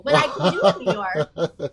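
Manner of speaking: laughing New York accent; a quick, laughing New York accent. Shown as a demonstration. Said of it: "that was supposed to be Chicago".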